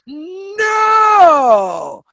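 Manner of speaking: long, loud, high drawn-out no; emphatic no. Shown as a demonstration. Urging speakers to turn down unpaid gigs, emphatic drawn-out no. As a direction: belt it out and stretch the vowel. Rousing group to refuse.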